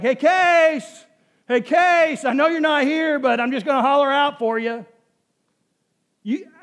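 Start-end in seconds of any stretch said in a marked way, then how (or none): 0.0-4.9 high, loud call through an empty house; hollering. Pretend. Husband mock-calling his absent wife at home, hollering. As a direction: holler out for someone who isn't there. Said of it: "I don't go around hollering for her".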